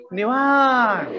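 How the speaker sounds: imitating someone utterly at ease; leisurely reply. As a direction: draw it out and let it sink, mock-idle. Mimics people's leisurely stock answer.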